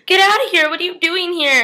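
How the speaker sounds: high, loud startled yell; startled shout. Acted. Elena catching Damon in her bed, high startled shout. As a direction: shriek at the intruder in bed.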